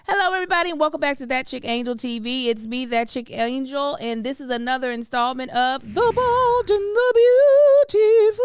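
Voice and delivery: high, loud, bubbly host welcome; hype show intro. Acted. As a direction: greet the audience like a TV host. Show opening greeting to viewers.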